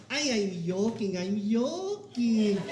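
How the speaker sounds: put-on slow, drawn-out Cuban mom accent; horrible Cuban accent. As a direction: imitate mom's thick Cuban accent, very slowly. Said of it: "horrible Cuban accent".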